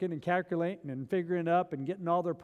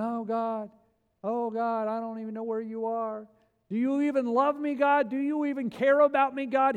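whining doubter; 4 semitones higher than usual. Retold or imitated. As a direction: complain to God like a forgotten child. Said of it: "we're going".

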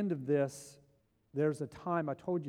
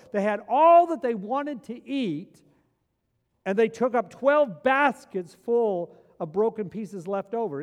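loud, high, building sermon climax; loud, high fervent preaching; preaching at full force; 8 semitones higher, 9 dB louder than usual. Acted. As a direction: preach it out with rising fervour.

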